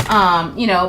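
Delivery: ordinary talk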